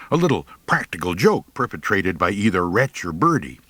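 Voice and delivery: plain reading voice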